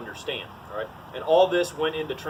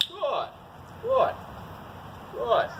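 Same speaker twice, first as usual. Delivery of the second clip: high, bright good good good; praising the dog; 10 semitones higher, 4 dB quieter, much slower than usual. Real, not acted. Trainer praising his lab for sitting, much higher. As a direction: reward him with your voice.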